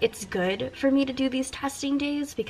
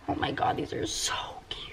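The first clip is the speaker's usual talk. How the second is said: hushed unvoiced delight; awed whisper; 6 dB quieter than usual. Real, not acted. Admiring new wax bars, an awed whisper. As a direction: whisper it over something adorable. Gushing over cute wax.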